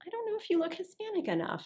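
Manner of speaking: high, quick mimicked rejection; high-pitched audition brush-off; casting director. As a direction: imitate a dismissive casting person in a high, fast voice. Casting people's verdict at auditions, voiced.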